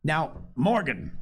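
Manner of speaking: slow, stern address; lightly voiced stern summons. A narrator's reading. Landlord questions old sailor Morgan, sternly. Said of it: "said Long John very sternly".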